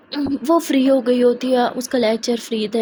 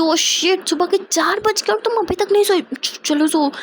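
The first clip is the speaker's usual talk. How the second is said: startled; 7 semitones higher than usual. Acted. Shocked it's four a.m.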